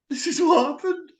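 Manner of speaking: high, teary imitation of her sobbing; mock crying voice. Caller mimicking the shop girl bursting into tears, mock sob. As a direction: fake a whimpering cry, hammed up. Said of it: "She burst into tears".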